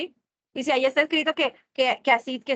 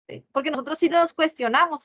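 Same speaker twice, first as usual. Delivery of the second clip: ordinary talk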